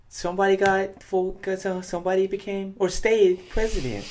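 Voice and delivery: news reporter delivery; solemn reporter read. Pretend. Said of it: "Why are you talking like that?".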